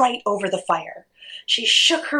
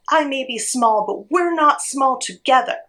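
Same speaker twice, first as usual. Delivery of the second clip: high, determined parrot call; rally them with a raised, higher voice; mild storybook shout back; 7 semitones higher than usual. A narrator's reading. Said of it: "the parrot shouted back".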